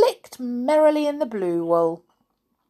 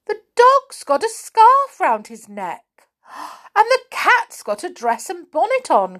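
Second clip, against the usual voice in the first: high, breathless cry of alarm; cried out; 11 semitones higher, 5 dB louder than usual. A narrator's reading. Sally spotting the dressed-up dog and cat, high alarmed cry. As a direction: gasp it out in shock. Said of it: "cried Sally".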